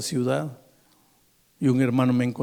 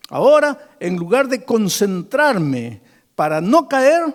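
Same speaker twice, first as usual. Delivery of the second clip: loud, high, impassioned preacher; fervent sermon climax, pitched high and loud; full-force preaching; 10 semitones higher, 7 dB louder than usual. Acted. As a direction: cry out the prayer at full voice, urging listeners on.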